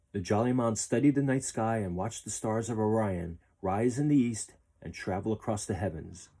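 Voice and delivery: ordinary delivery, nothing marked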